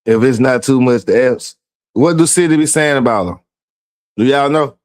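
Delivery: dying to know the gossip; nosy excitement. Real, not acted. Nosy asking about her.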